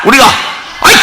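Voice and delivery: ordinary delivery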